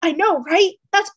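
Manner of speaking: bright burst of enthusiasm; excited exclaim. Acted. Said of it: "exclaims Lance".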